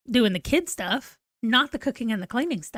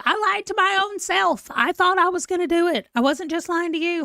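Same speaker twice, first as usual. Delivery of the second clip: high, pleading mock protest; mock defensive self; 7 semitones higher than usual. To her husband Ron, a high whiny mock defence. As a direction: squeak out a hammed-up excuse.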